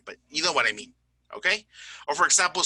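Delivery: normal talking voice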